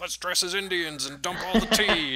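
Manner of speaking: half-mock crowd cheer; riled-up drunk crowd. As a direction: egg each other on like drunks. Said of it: "and they're like".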